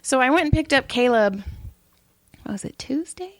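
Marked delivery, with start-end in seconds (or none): 2.4-3.3 high, whispery aside; hushed memory check; muttered self-question. Real, not acted. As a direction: wonder it softly to yourself. Asks herself mid-story.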